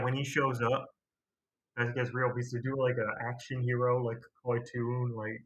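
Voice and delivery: normal talking voice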